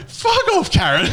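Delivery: loud high yelp, laughing through it; laughing retort. Retold or imitated. Fighter yelling back at the woman outside his van. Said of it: "I go".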